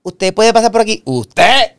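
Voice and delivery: high, overly polite usted; polite young stranger. Retold or imitated. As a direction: mimic a youngster politely calling you usted. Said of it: "y dice".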